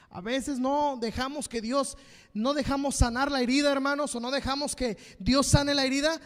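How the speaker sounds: loud, high, driving sermon; preaching at full force. Real, not acted. Preacher rebuking the church, loud and high. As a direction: build and hammer it home.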